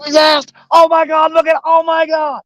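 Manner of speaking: high-pitched couch-fan outburst; thrilled mock shouting; acted-out excited yelling. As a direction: re-enact leaping up at a big catch. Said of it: "I'll jump up off the couch".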